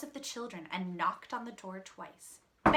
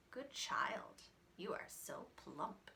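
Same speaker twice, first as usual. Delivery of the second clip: whispered, hungry wolf; greedy whisper; 2 semitones lower, 10 dB quieter, slower than usual. Acted. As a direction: breathe it soft and greedy.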